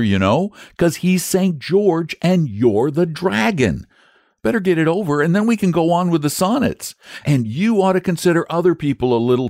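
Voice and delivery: put-on high, pleading young boy; imploring boy. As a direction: coax him like an eager child. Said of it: "said the boy imploringly".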